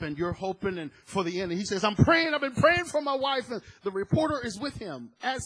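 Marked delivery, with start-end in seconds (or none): 1.8-3.5 high, loud retold plea of a frantic husband; desperate pleading. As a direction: voice him straining with hope, much higher and louder. Desperate husband quoted in wildfire.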